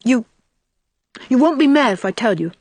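high, hesitant little plea; halting childlike imploring. A narrator's reading. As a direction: plead like a small boy, pitch lifted. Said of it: "implored Davy".

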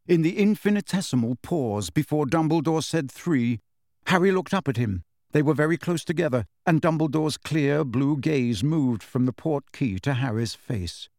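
usual speaking voice

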